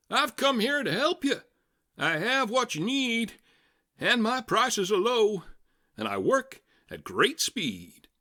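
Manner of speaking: high, bright huckster pitch, often loud; clear, keen salesman voice. A narrator's reading. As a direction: sell it like a fast-talking travelling fixer. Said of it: "he announced in a voice clear and keen".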